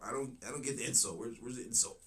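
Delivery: ordinary talk, nothing marked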